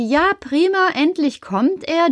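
freudig hoch, erleichtert. Acted. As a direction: endlich Rettung, hoch ansetzen. Erleichterung über Karls Ankunft. (erleichtert jubelnd, viel höher)

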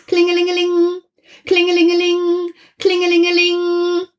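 high ringing voice; phone ring. Acted. The telephone rings again in the night, high ringing.

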